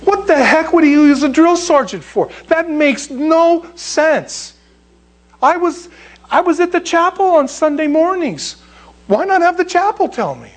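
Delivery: high-pitched mock exasperation; incredulous protest. Preacher questions God using a drill sergeant, high incredulous protest. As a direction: question it in high, baffled disbelief. Acts out protest at God's choice.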